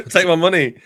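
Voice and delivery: high, loud and laughing; excited. Real, not acted. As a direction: blurt it out, thrilled, still laughing. Eager joke about paying.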